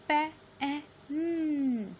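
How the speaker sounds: loud, slow पैन with hummed final n; sounded-out short A. Shown as a demonstration. Teacher shows the short vowel, sounding out पैन. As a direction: break पैन into "pa - a - nnn". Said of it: "short vowel sound है".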